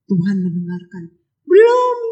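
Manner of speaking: ordinary talk, nothing marked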